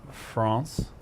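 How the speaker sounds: "France" said as "Frahns"; mock-French vowel in "France"; French-style pronunciation. Shown as a demonstration. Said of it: "I think I pronounced it correctly".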